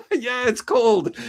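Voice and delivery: laughing through the words; amused laugh about the cold. Real, not acted. Laughs while remarking on cold.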